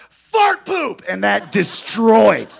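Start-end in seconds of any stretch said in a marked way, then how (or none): 0.1-1.0 high, loud shout of Fart poop; kid yelling. Retold or imitated. Kid heckler yells from the crowd, shouting high. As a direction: yell it like a proud little heckler. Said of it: "this kid stands up ... and yells".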